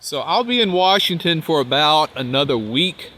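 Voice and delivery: ordinary talk, nothing marked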